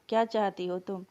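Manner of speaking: plain reading voice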